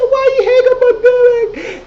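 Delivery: hammed-up tearful squeal; mock wailing protest. Imitating Tiffany when they hang up on Derek, mock wailing protest.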